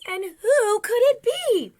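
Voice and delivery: put-on very high, loud baby bear; wee little voice. A narrator's reading. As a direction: squeal the question as Baby Bear. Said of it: "in his wee little voice".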